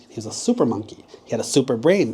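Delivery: ordinary delivery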